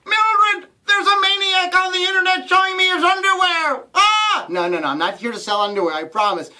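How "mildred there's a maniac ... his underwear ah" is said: high, loud panicked voice; mock horror, pitched way up; shocked viewer. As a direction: shriek to Mildred in alarm. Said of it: "Now you're gonna go like".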